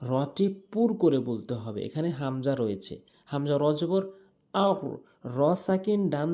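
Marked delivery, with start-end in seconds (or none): none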